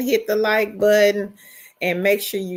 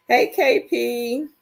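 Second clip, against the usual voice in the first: ordinary talk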